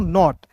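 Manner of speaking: नॉट with an open aw vowel. Shown as a demonstration. Teacher models the middle O rule, open aw in नॉट. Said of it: "इसका उच्चारण औ के रूप में".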